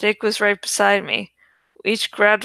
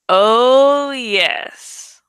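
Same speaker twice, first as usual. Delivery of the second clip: grinning; 3 semitones higher, 5 dB louder than usual. A narrator's reading. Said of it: "I grinned".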